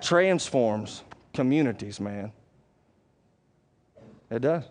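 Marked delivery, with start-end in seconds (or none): none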